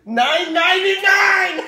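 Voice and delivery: high, loud, drawn-out squeal; thrilled shout. Real, not acted. Parent announcing strip price, shouting with glee. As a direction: belt the price slowly, then laugh.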